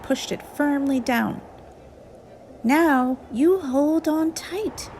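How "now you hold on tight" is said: mildly stern little voice, higher and slow; a child's gentle sternness, slow and high. A narrator's reading. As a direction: tell the leaf firmly to stay put. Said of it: "said Fletcher sternly".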